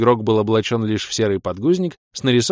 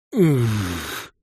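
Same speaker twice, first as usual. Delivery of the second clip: creaky grunt. A narrator's reading. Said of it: "проскрипел коварный змей".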